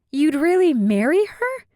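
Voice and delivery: high, loud, incredulous question; can't believe what she's hearing; shocked disbelief. Acted. Callie confronting Bay about the wedding, loud, high disbelief.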